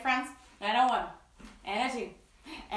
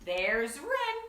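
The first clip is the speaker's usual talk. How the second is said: ordinary talk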